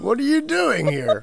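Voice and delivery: a hint of a startled parent, half laughing; mock startled parent. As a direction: act caught off guard by the kids at home, lightly. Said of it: "Yeah, you're like".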